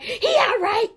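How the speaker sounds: mock her in a shrill exaggerated tone. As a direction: friend imitated mockingly. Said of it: "that's my voice again".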